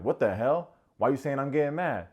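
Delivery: hammed-up angry retort; mock indignation. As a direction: protest loudly, acting out the offence. Acts out JR getting mad.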